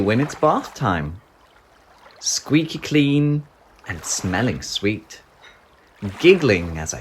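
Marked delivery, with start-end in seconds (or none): none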